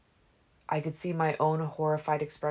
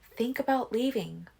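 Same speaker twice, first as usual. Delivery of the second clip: put-on higher, pressing girl's voice; Alice's voice; 8 semitones higher than usual. A narrator's reading. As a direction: urge him to think it over.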